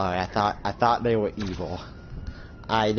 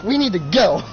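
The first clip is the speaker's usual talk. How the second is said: urgent; 8 semitones higher, 5 dB louder than usual. Acted. Urgent departure.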